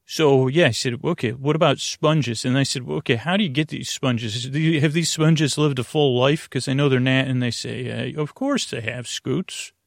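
usual speaking voice

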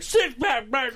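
high, loud mock-rowdy woman; imitated ferry lady. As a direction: call it out loudly in her put-on high voice. Voices the woman slamming down beer.

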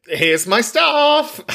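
high, slow, drawn-out proclamation; hammed-up "ta-da" declaration; big mock announcement. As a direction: sing it out grandly, slow and high. Acts out someone baring it all.

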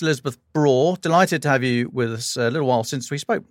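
ordinary talk, nothing marked

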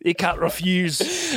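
put-on slow, drawn-out Arnold accent; stretched Austrian action-hero accent; Arnold Schwarzenegger accent. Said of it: "doing Arnold".

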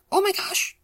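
mortified little shriek; embarrassed squeal. A narrator's reading. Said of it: "buried it so far into the ground".

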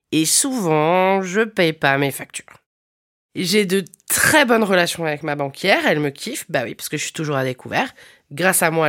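ordinary delivery

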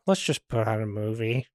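soft, low, sheepish suggestion; deflated. Retold or imitated. Kids walking back with heads hung, quiet deflated suggestion. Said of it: "hung our heads and walked back".

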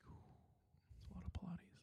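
whisper. Real, not acted. Teasing whisper aside.

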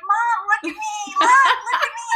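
put-on high childlike whine for attention; dating-app guys voiced as kids begging mom, high and pleading; imitating a kid. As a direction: beg like a little kid to be watched. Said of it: "they're just like".

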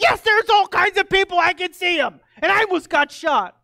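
high, loud outraged rant; a hammed-up furious old lady; angry neighbor. Pastor imitating the neighbor yelling at him, high and loud. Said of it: "she just laid into me".

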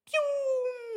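high whooshing pew; cartoon speed zip; zip sound effect. Guy running off.

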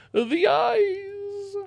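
very high held wail on eyes; mock wail. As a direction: belt it out like an opera finale.

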